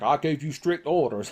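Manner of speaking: a light stab at a stern boss; lightly voiced angry wrestling boss; a hint of scolding McMahon; Vince McMahon impression. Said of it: "That's my Vince McMahon impression".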